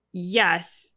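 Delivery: usual speaking voice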